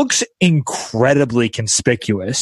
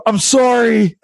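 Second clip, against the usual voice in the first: high-pitched mock apology yell; retold yell; 10 semitones higher, 3 dB louder, slower than usual. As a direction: call out a hurried apology, half shouted. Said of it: "I just yelled".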